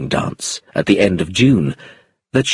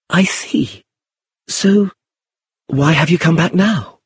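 high, lightly voiced young woman; Iris, inquisitive; 7 semitones higher than usual. A narrator's reading. Iris asking why he has returned, inquisitive. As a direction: pitch it high and curious. Iris questions him while dancing.

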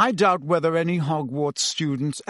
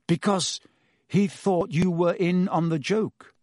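higher voice, thinking aloud in pauses; slowly, working it out; 4 semitones higher than usual. A narrator's reading. Harry reasons with Lupin about the joke, slowly. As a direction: reason it out haltingly. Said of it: "said Harry slowly".

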